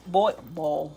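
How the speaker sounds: light Chicago accent on boil. Shown as a demonstration. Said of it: "You're from Chicago".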